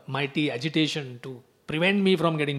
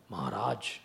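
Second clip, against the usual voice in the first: soft, low flattering courtier voice; butter up the king quietly; fawning flattery; 10 semitones lower, 11 dB quieter than usual. Retold or imitated. Advisors flattering King Kamsa, soft and low.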